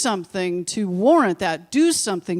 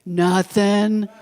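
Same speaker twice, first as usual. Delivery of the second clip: lightly mimicked innocent kid; drawn-out mock-innocent denial; guilty child; 3 dB louder than usual. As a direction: deny it like a child caught out. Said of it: "all of you say".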